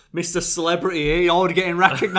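loud, high ribbing from a mate; imitating a friend's loud tease; teasing mockery. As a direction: jeer it loudly, playing up the title. Acting out friend teasing him.

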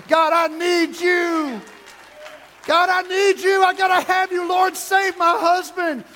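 fervent, high, loud plea to God; full-force pleading shout; urgent crying out. Acted. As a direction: cry out desperately in prayer, drawing the words out. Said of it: "in an urgent way".

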